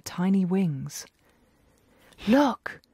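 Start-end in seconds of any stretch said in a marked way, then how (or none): none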